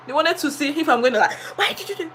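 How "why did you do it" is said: tearful scolding. Retold or imitated. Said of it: "they are testing me".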